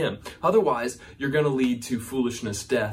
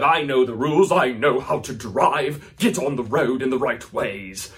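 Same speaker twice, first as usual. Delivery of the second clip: loud indignant rant at the road; mock angry driver; 6 dB louder than usual. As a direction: vent like a self-righteous driver.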